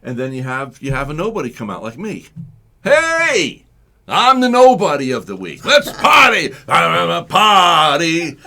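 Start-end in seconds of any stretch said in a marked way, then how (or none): 2.8-8.4 high, loud party-host holler; hype-man shout. Acted. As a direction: whip up the crowd as a party animal. Acts a nobody hyping the crowd.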